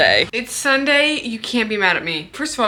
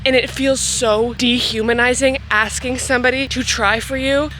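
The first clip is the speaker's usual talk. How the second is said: ordinary talk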